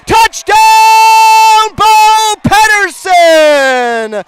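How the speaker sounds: building to a high, loud shout; touchdown call. Real, not acted. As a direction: scream the return all the way home. Punt return touchdown call.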